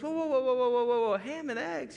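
high-pitched, loud string of protest, easing at the end; alarmed pig. Acted. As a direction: hit the brakes in comic alarm. Pig balks at breakfast plan.

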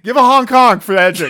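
loud laugh through the words; cracking up mid-joke. Real, not acted. Joking honk request while laughing.

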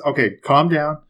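ordinary talk, nothing marked

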